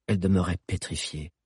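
ordinary delivery, nothing marked

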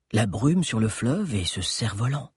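ordinary delivery, nothing marked

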